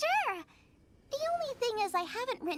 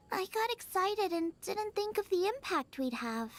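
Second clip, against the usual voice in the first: ordinary delivery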